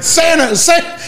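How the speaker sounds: high-pitched coach's call; imitated calling out. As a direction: call a player over from afar. Coach calls a player over.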